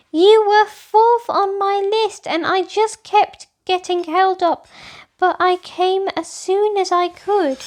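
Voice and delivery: lightly voiced little girl justifying herself; reporting back. A narrator's reading. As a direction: explain yourself quickly, a bit defensive. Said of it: "reported back".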